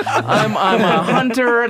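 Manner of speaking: high, slow, sheepish answer; meek reply. Retold or imitated. Hunter explaining himself to the cops, high sheepish answer. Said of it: "I'm like".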